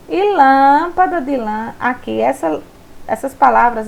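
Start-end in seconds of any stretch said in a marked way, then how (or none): 0.3-1.2 "lâmpada" with "lâm" drawn out strongly; stress on first syllable. Shown as a demonstration. Teacher showing which syllable is stronger, long stressed "lâm" in "lâmpada". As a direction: stretch and punch the opening "lâm" of "lâmpada". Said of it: "pronunciadas com mais intensidade".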